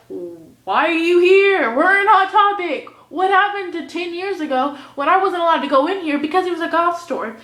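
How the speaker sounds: high, loud, hammed-up outrage; play-screaming at someone, pitched well up; mock screaming rant. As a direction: yell it like a pretend public meltdown. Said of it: "start screaming at a Barbie".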